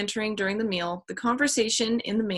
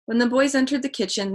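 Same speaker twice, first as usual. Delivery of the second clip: ordinary delivery, nothing marked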